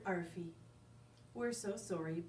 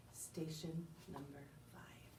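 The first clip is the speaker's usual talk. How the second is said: whispered sign-off; 2 semitones lower, 8 dB quieter than usual. Acted.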